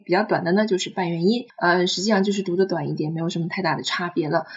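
ordinary talk, nothing marked

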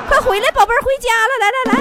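loud, high mock summons; calling out. Man calling his lost money back home, high loud call.

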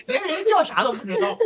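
high laughing, near tears; laughing hard. Real, not acted. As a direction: crack up while repeating. Repeats it, laughing harder.

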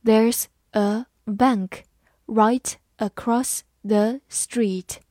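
drawn out word by word; slow, careful demo read. Shown as a demonstration. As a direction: read it slowly so students can copy. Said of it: "好，慢慢来读。".

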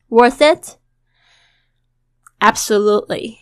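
"Worth it" run together as "wor-thit"; teacher demonstrates linking; linked speech. Shown as a demonstration. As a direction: glide "Worth it" into one linked sound. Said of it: "把英语连起来".

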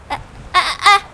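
sudden high alarmed yelps; startled cry. Acted. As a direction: cry out as the phone is grabbed. Phone snatched from his hand. (惊慌地叫喊)

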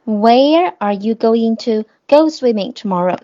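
slow, clear, word by word; slow model reading. Shown as a demonstration. Teacher models the English answer slowly for the class. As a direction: spell each word out for the students. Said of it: "加个动词，加go".

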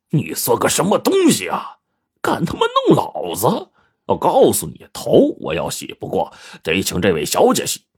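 indignant thug, pitch jumping up then settling; bullying lout. A narrator's reading. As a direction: throw your weight around, then make demands. Fat thug struggles up, insults barber.

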